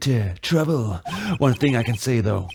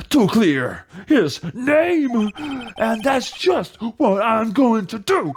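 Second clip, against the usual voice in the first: slow, a touch higher and determined; heroic resolve; 10 semitones higher, slower than usual. Acted. Rookie lawyer vowing to clear friend, slow mild heroic resolve. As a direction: declare it like a hero.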